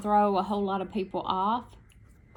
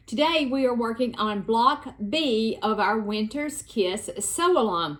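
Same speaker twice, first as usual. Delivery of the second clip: ordinary talk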